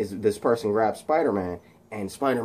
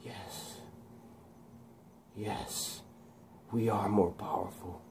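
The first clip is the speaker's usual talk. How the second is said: hushed, whispered villain-to-be; imitating sad Max; 2 semitones lower, 14 dB quieter, slower than usual. Max giving in to the voice, acted near-whisper. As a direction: whisper it with long pauses between phrases. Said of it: "and he gets sad".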